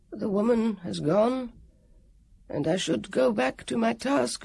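a guardian who has failed his task; mournful warrior. Acted. Warrior after sighing.

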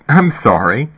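ordinary delivery, nothing marked

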